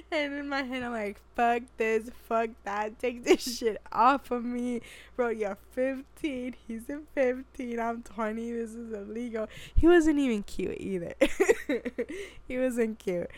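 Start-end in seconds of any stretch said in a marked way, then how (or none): 1.3-9.5 tag. Retold or imitated. Retelling her horrified inner rant. 9.7-13.3 higher, louder, breaking into laughter; laughing aside. Real, not acted. Joking aside while laughing.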